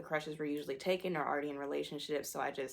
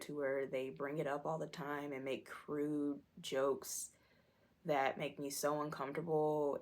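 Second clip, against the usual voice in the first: normal talking voice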